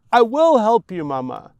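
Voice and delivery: loud, high burst of a promise; blurting it out desperately; crying out. Acted. Boy giving in to his sobbing mother, loud.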